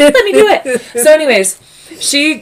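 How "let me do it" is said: high, loud mock-eager voice; eager Shauna. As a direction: pipe up high and loud, imitating her. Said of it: "Shauna was like".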